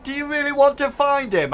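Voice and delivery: very high, loud ruffian; questioning ruffian. Acted. Ruffian asking the android about finding Hank, high and loud. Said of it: "one of them says".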